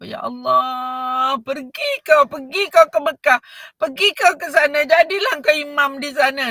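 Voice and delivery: a mother crying as she scolds; a touch of weeping anger; tearful scolding. Retold or imitated. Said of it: "Dengan menangis-nangis, mak dia marah".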